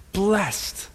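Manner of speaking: a light high "blessed", single syllable blest. Shown as a demonstration. Settling the word for the audience, a high "blessed". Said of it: "that's my Wisconsin accent".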